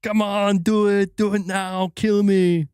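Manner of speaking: loud, high Arnold Schwarzenegger yell; Arnold Predator shout. Pretend. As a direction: taunt the monster like Arnold.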